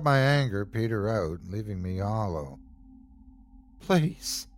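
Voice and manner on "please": begging. A narrator's reading. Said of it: "I begged again".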